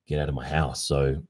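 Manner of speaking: ordinary delivery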